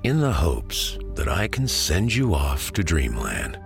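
ordinary delivery

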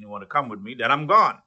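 ordinary talk

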